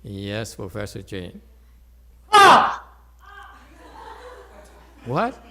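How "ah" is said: a sudden high Ah at full volume; startling shout. Shown as a demonstration. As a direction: blast it out to startle. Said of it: "That's the shout".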